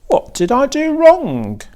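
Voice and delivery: put-on high, puzzled young pig; a pig asking the spider after his fall; Wilbur the pig. A narrator's reading. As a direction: ask it high and bewildered, like a pig who fell. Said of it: "asked the pig".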